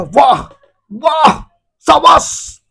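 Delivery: high, excited cheering; cheering friend. Retold or imitated. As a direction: whoop it up like a proud buddy. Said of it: "तो दोस्त बोलेगा".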